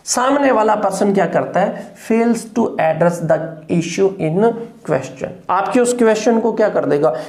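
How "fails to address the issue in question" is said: slow dictation. Shown as a demonstration. Repeats definition slowly.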